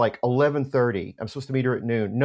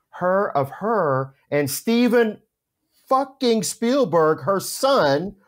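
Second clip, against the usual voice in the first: higher, slow, every word drawn out in disbelief; stunned; 6 semitones higher, 4 dB louder, slower than usual. Real, not acted. The teller reveals whose mother she is, slow and stunned. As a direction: spell out the shock slowly. Retells his shock at the photo.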